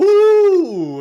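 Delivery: high, loud flourish; showy ooh. Acted. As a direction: sing out the ooh like a hype man. Host hyping the show intro.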